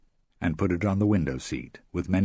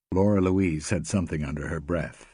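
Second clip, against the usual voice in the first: plain reading voice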